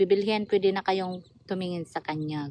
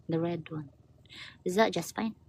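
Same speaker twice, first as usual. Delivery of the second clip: usual speaking voice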